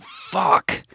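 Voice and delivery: high-pitched cry of one cuss word; sudden high scream, narrated. A narrator's reading. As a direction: scream it out in relief. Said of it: "she screamed".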